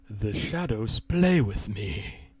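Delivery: slow, soft, low demonic menace; eerie, drawn-out demon murmur. A narrator's reading. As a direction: draw it out like a quiet demon. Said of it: "truly sounded like a demon".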